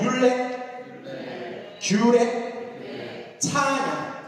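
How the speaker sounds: words stretched slow and high for the crowd to repeat; teacher leading a chant; call-and-repeat drill. Shown as a demonstration. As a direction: spell each word out long and high. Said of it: "따라해봅시다".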